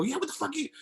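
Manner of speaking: can't believe what he's hearing; incredulous outburst. Retold or imitated. Retold answer to Alicia about the kids, incredulous outburst.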